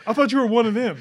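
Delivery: a half-hammed cry of alarm; mock startled shout. Dad bashes him, quoted shout.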